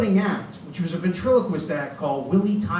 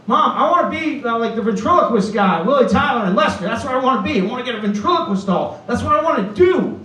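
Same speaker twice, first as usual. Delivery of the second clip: put-on high, loud, eager child voice; excited kid; 5 semitones higher, 4 dB louder than usual. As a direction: plead with Mom like a thrilled little boy. Acts his excited child self.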